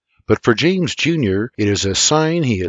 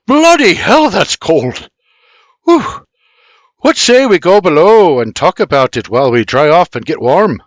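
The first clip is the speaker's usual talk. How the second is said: much higher, loud startled shout; shocked by cold water; 7 semitones higher, 7 dB louder than usual. Acted. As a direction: yelp at the icy spray. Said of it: "pelts them all, shaking off water".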